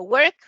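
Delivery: "work" said loud as a base form; model word. Shown as a demonstration. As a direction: give "work" clearly. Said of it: "Nada más ponemos".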